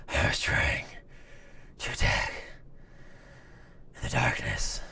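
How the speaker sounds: whispered superhero rasp; a hushed, voiceless growl, broken by pauses; Batman. Acted. Superhero confronting his villain in the darkness, hushed whisper. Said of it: "where Batman takes on Bane".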